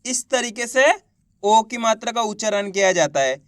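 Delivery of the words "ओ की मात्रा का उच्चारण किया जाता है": ordinary talk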